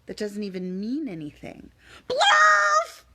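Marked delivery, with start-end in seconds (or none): 2.1-3.0 high, loud nonsense yelp; yelled "Blurf!" way up high; silly shout. Acted. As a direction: bark "Blurf!" as a shrill burst. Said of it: "Wait a second, what?".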